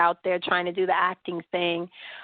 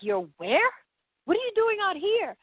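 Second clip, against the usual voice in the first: shocked friend; 11 semitones higher than usual. Retold or imitated.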